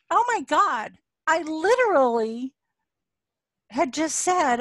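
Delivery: high, loud "oh my God" wonder; retold amazement. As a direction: relive the shock of the coincidence. Stunned by the coincidence.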